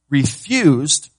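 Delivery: refused hit hard and loud; stress on refused. Shown as a demonstration. Preacher leaning on refused for the congregation. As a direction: land the word refused with weight. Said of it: "This word refused is so important".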